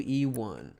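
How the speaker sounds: ordinary talk, nothing marked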